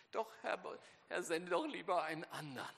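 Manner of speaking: a lightly voiced, begging Moses; pleading Moses. Moses begging God to send someone else, lightly pleading. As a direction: beg to be let off. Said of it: "haut er noch einen drauf".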